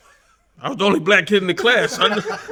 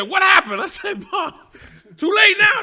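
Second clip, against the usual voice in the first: shouted exchange; 13 semitones higher, 3 dB louder than usual. Retold or imitated. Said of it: "she said".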